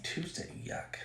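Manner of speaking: hushed whispered disgust; whispering. Real, not acted. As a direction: whisper it with a grimace. Whispered aside during live stream.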